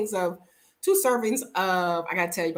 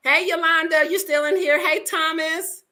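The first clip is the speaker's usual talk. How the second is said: higher, louder call across the room; 9 semitones higher, 4 dB louder than usual. Real, not acted. Calling out to Yolanda and Thomas in the building. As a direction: call out to someone nearby.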